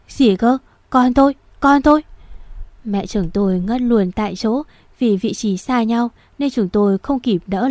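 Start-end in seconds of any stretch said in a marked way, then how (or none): 0.1-2.0 high, loud, hurried gasp of alarm; a mother's panicked disbelief; shocked cry. Acted. Mother hearing her son may die, shocked cry.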